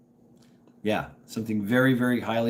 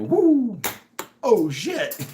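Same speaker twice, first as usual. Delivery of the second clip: mock surprise; 10 semitones higher, 4 dB louder, much slower than usual. Acting gun falling on dance floor.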